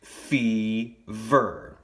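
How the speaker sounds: "fever" in two long beats, fee, ver; clapped-out syllables. Shown as a demonstration. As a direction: break "fever" slowly after fee. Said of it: "when you clap that first chunk".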